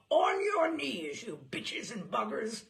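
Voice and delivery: slow, loud preacher's bellow; drunken pulpit shout. Retold or imitated. Drunk clergyman roaring at his parishioners, loud and slow. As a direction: roar it down at the congregation from the pulpit. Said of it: "he got up in the pulpit and shouted".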